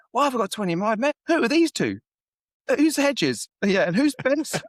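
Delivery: high, loud mock-furious yelling; retold shouting. Manager yelling at his secretary about extra players, high loud mock shout. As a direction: bellow it like a manager losing it over the squad. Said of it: "shouting at his secretary".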